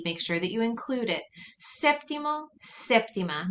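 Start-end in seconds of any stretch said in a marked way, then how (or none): 1.8-3.5 "Séptimo, séptima" each stressed on the first syllable; stress on accented E. Shown as a demonstration. Teacher models both forms, "Séptimo, séptima" stressed on sép. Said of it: "that's also where you put your emphasis".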